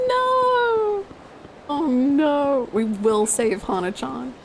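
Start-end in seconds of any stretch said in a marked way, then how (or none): 0.1-1.1 long, high-pitched "no"; dismayed wail. Real, not acted. Dismayed for Hana in the game. 1.7-2.7 mock dismay. Real, not acted. Mock dismay over Hana's woes in game.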